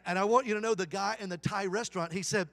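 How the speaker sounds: usual speaking voice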